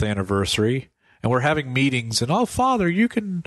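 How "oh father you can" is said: high-pitched imitation of a parishioner; eager parishioner. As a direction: chirp a request at the priest. Parishioners' eager request acted out.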